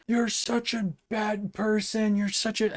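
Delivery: a high, hammed-up angry jab; mocking a spitting heckler; mock vicious rant. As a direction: parody the guy's vicious tirade. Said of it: "viciously going after the politician".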